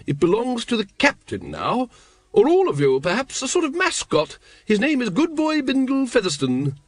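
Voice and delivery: high-pitched, gushing lady; high voice of an enthusiastic lady; hearty grand lady. Acted. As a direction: present the pet grandly, pitched well up. Voices Lady Ramkin in story.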